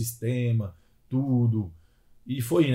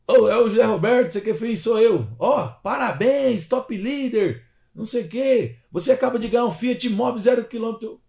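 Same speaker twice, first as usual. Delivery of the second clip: booming prize announcer; hyped-up prize announcement; game show host; 8 semitones higher, 4 dB louder than usual. Retold or imitated. Prize caller announces the car win.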